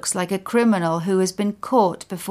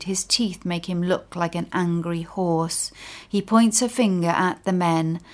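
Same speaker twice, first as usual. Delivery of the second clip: ordinary delivery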